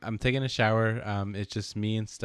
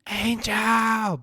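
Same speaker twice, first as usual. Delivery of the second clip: higher, louder call of a name; retold yell; 13 semitones higher, 6 dB louder than usual. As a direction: call the name across the house. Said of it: "I just hear someone yell".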